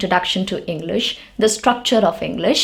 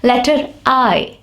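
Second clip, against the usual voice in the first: usual speaking voice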